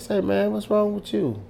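high-pitched quoted challenge; high, re-enacted questioning; retold confrontation. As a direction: call him out in a high voice.

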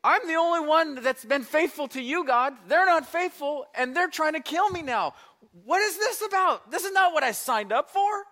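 loud, high, indignant complaint to God; hammed-up protest, voice pushed high; protesting Elijah. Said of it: "Do you hear what he's saying?".

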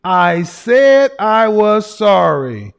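loud, slow, exasperated I said I was sorry; mock attitude. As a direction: drag it out loudly like she's fed up. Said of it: "she says it with an attitude".

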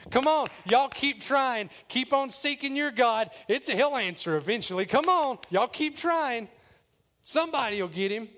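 high, loud goading; mocking. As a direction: egg them on with fake encouragement. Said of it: "he starts mocking them".

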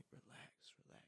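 breathy hushed relax; unbothered friend whispering during exam panic; chill friend. Retold or imitated. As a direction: whisper it like nothing matters. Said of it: "he just keeps saying to me".

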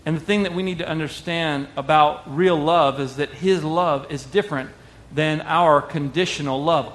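usual speaking voice

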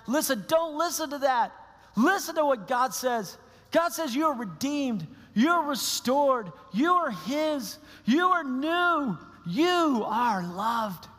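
high, ringing declarations with drawn-out words; impassioned preaching. Acted. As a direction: proclaim each phrase slowly and emphatically. Preaches God's affirmations at full intensity.

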